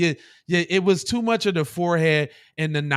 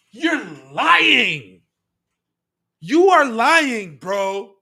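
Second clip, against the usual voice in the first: incredulous callout; 5 semitones higher, 6 dB louder, much slower than usual. Real, not acted. Calling out clip's claim.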